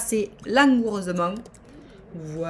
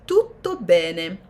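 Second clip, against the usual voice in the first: mock Italian; 4 semitones lower, 3 dB louder, much slower than usual.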